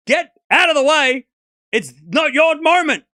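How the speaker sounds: loud, high and fed up, some words slowed; ranting yell. Real, not acted. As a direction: yell the rant at the crowd. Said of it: "Stop screaming".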